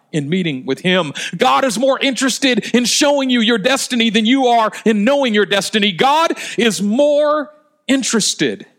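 high-pitched, insistent pulpit delivery; impassioned preaching. Real, not acted. Preacher urges congregation about their destiny, full-force preaching. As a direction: drive each repeated phrase home to the congregation.

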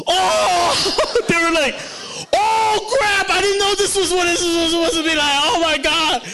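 high, loud, hammed-up cry of shock; mock wailing crowd. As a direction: wail it like people caught red-handed. Group caught, crying out.

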